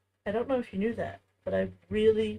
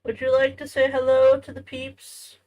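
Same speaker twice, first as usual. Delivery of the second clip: louder, lifted voice on hello; 4 semitones higher, 9 dB louder than usual. Real, not acted. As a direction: encourage the little one to greet the viewers. Coaxing child to greet viewers.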